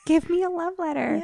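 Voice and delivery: high-pitched, pretend lovesick plea; hammed-up begging, way up high; playful mock swooning. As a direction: squeal it sweetly as a joke demand. Mock swooning demand for love letter.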